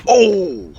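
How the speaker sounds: sudden high, loud cry of alarm; loud high yelp of surprise; startled yelp. Real, not acted. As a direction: caught off guard mid-fall. Horse falls from height.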